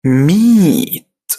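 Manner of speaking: ميت stretched very slowly on the long ee. Shown as a demonstration. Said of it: "في النطق التالت اللي هي كلمة".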